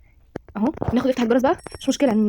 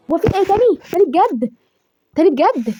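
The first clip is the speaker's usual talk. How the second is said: high-pitched, incredulous questioning; exasperated disbelief; 6 semitones higher, 4 dB louder than usual. Real, not acted. Player facing the same disaster, exasperated disbelief.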